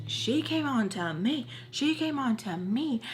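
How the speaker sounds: high, repeated protest with swooping pitch; mock him pleading his innocence; imitating a guilty guy's whine; whiny excuse. Retold Bobby's protesting excuse.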